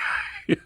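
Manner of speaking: unvoiced airy chuckle; quiet laugh under the breath; breathy laugh. Real, not acted. Laughs at slap story.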